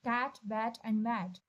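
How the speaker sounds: Cat, bat and mat said very slowly, each with the same a; same a vowel. Shown as a demonstration. Teacher slowly showing students the shared a vowel. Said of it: "सब में ए का sound".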